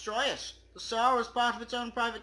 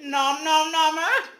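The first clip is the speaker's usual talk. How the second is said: louder, playful shout of the name; loud mock cheer; 6 semitones higher, 7 dB louder than usual. Hamming up the player's name, loud playful shout. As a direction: belt the silly gamer name.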